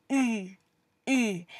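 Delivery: "e" said as a mix of uh and ee, quiet; hard e demo. Shown as a demonstration. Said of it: "this is considered the hard version of e".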